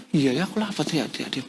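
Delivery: soft, quick mumble to oneself; meek giving in. Acted. Preacher acting us giving up when told to be quiet, meek. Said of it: "Kalau kita mungkin".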